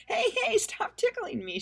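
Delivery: high, loud squealing shriek. A narrator's reading. Pippi shrieking at the tickling bull. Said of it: "shrieked Pippi".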